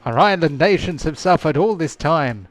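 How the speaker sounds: high, loud jubilant elf voices; excited elves. Acted. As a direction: cheer like a relieved crowd. Elves celebrate the Devil's Mouth gone.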